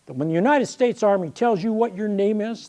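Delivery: ordinary delivery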